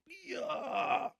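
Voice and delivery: very high, strangled angry yell; furious yell. Retold or imitated. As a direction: burst out in fury on one word. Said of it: "steam coming out of his ears".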